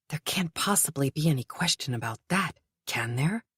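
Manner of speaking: a barely voiced whisper of doubt. Kurt doubting himself inside, whispered. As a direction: let the question die to a whisper. Kurt's anxious inner doubt.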